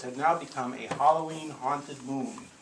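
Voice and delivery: plain reading voice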